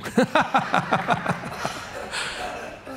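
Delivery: soft amused laugh. Real, not acted. Pastor laughing to the congregation, soft amused laugh. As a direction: let out a soft laugh at his own joke.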